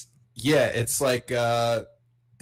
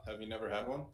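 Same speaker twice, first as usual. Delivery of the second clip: ordinary talk, nothing marked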